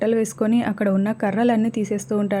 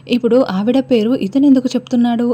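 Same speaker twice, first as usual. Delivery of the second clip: louder, sharp annoyed question; irritated outburst; 2 semitones higher, 7 dB louder than usual. A narrator's reading. Meera, angry at the patient naming Sharada, snaps loudly. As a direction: snap it out in irritation. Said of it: "అని మీరా మండిపడుతూ ఉంటుంది".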